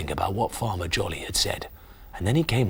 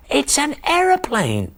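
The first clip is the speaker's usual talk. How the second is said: much higher wonder; amazed. Acted. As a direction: gasp it in wonder. Discovering the plane.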